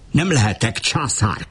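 a cry of protest, pitch up, stress on Nem; shouting. A narrator's reading. Tia to Uri, insisting he cannot be emperor, shouting. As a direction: angrily call out that he can never be emperor. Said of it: "kiáltotta".

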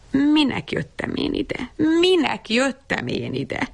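high, lamenting refrain; dazed repeating. A narrator's reading. Bab Berci left outside the slammed door, repeating dazedly. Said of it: "és azt hajtogatta".

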